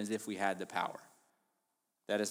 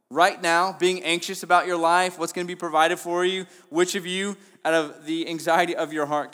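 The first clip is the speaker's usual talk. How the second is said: louder, higher, urgent preaching voice; full-force preaching; 6 semitones higher, 8 dB louder than usual. Acted. Preacher urging the congregation about anxiety, forceful. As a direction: drive the point home to the room.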